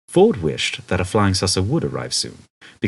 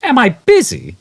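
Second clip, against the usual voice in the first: high, loud incredulous exclaim; 9 dB louder than usual. A narrator's reading. Arthur exclaiming at Ford's question, incredulous. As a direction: fling the question back in disbelief. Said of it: "exclaimed Arthur".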